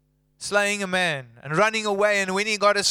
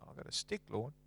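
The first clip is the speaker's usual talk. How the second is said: sheepish whisper; 7 semitones lower, 18 dB quieter, faster than usual. Retold or imitated. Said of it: "Moses' small reply".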